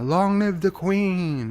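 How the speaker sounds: slow, high British caricature; mock British accent. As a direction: ham up a silly British accent, drawn out. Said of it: "things British people say".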